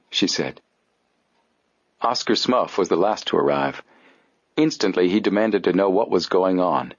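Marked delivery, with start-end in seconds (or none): none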